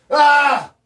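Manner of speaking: loud, high cry of anguish; real loud anguished shout. Real, not acted. Overwhelmed, crying out.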